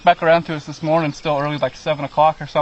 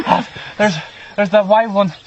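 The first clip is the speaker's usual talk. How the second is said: excited mock panting, higher pitch; imitating out of breath; 5 semitones higher than usual. Hunter runs up to report the birds, mock breathless. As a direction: act winded and urgent, pitched up. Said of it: "like, all out of breath".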